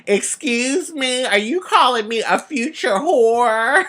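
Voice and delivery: sass her with a hand on the hip; sassy retort. Pretend. An imagined sassy child confronts the fortune teller, indignant. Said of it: "hands on my hip and saying".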